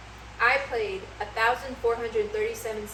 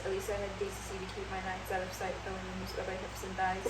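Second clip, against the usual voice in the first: rattled-off lyrics; 3 semitones lower, 10 dB quieter, faster than usual. Shown as a demonstration. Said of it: "What did you just say to me?".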